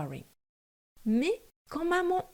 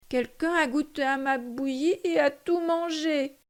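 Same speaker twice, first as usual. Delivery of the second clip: put-on small whiny baby bear, a bit higher; Baby Bear whining; 5 semitones higher than usual. A narrator's reading. Narrator voicing Baby Bear's whine over his empty bowl. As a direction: whimper it like a tiny cub. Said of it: "pleurnicha bébé ours de sa toute petite voix".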